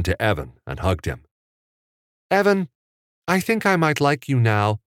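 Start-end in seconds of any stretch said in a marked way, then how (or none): 2.3-4.8 put-on matter-of-fact little boy, pitched high; high kid voice, very matter-of-fact; Richie. A narrator's reading. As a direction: state it simply in a high child's voice. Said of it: "Richie said very matter-of-factly".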